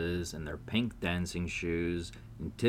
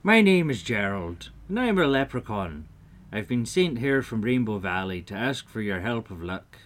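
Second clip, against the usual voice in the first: lightly voiced little leprechaun; lilting, emphatic character voice; friendly leprechaun; 3 semitones higher, 6 dB louder than usual. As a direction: introduce yourself as a storybook leprechaun, a bit louder. Leprechaun introduces himself.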